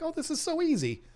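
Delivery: high, airy and carefree; breezy. Retold or imitated. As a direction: toss it off like it's nothing. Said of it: "And it's like".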